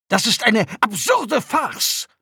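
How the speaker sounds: high-pitched, slow, emphatic outrage; indignant protest. A narrator's reading. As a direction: spit out each word in affronted disbelief. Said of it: "protestierte der Neuankömmling". (empörter Protest in hoher Stimme)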